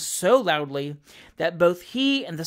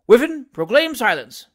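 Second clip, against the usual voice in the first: louder, lofty order; pompous command; 4 semitones higher, 7 dB louder than usual. A narrator's reading. Mayor ordering the crier, pompous and lofty. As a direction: give the order with pomp. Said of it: "with an air of pomp".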